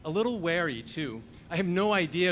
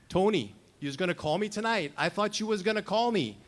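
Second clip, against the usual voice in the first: quick New York twang, pitched up in mock hurt; 5 semitones higher, faster than usual. Narrator voicing Robbie's reunion greeting, in a lightly played New York twang. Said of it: "he says in his own New York twang".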